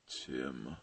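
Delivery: very low, soft plea; Batman. Acted. Hero appeals to his sidekick, low and quiet. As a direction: say it low and grave. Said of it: "Batman's looking at him like".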